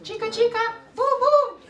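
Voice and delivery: tiny squeaky mouse chant, very high. Shown as a demonstration. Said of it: "say it like a mouse now".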